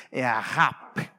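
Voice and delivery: ahap, breathy h and lips closing on p; Hebrew ahap. Shown as a demonstration. Preacher showing the Hebrew consonants, careful pronunciation. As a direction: pronounce ahap low and careful. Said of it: "uma consoante cultural e uma consoante labial".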